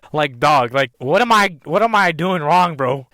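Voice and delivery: loud exasperated rant about being single; exasperated outburst. Real, not acted. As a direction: rant it out, fed up with himself.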